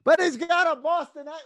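high-pitched mock complaint; mocking objection. Mocks people dismissing the actor.

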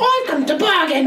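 high mock Cockney line; bad Cockney.

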